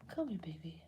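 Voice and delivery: soft whispered coaxing to the cat. Real, not acted. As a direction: breathe it, no voice.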